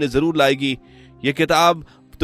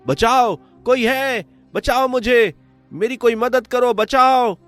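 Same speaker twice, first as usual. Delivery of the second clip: very high-pitched cries for rescue; desperate call for help; 4 dB louder, slower than usual. Acted. Trapped brother shouts for anyone to save him, desperate and high. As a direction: cry out, hoping someone hears you.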